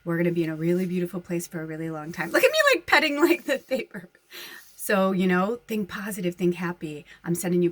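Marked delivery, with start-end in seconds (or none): 2.3-4.1 amused squeal. Real, not acted. Laughing at herself petting paper.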